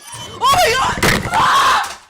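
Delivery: sudden terrified shriek; frightened scream. Real, not acted. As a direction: scream at the jump scare. Jump scare in horror game.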